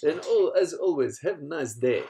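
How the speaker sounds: imitating a YouTuber's Russian catchphrase; Dmitry Popov Russian accent. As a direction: give it the big Russian farewell. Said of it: "he was doing this Russian accent".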